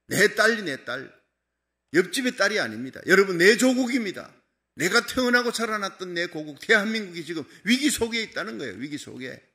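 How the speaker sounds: loud, rising pulpit climax; loud impassioned sermon peak; preaching at full force. Acted. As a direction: drive it home to the congregation.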